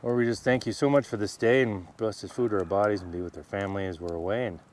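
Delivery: quiet mealtime prayer; simple thankful prayer; saying grace. Real, not acted. Prays over the meal.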